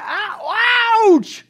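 long, high, loud "ouch"; mock pained yelp. Preacher acts out being tormented, mock pained yelp. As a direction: howl it out in mock agony.

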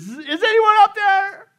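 loud, high, slow call to heaven; terrified cry for help. Acted. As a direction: cry out in acted terror. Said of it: "he's filled with terror".

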